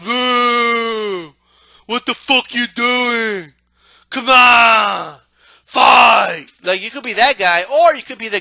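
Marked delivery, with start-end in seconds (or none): 0.0-6.5 slow, disgusted heckle, then louder; mock annoyed spectator. Fan booing the evasive fighter, slow annoyed heckle building louder. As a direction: heckle the dodging fighter in disbelief. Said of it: "the guy that goes".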